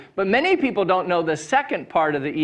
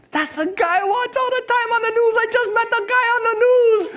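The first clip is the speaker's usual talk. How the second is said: very high-pitched, excited fan imitation; all excited. Excited man telling his wife who he just met, thrilled. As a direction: gush it in a high, thrilled squeal of words. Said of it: "he's all excited".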